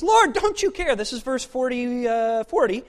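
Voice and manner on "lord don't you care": loud, indignant complaint; angry. Acted. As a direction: act out Martha's angry protest. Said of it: "Martha gets angry".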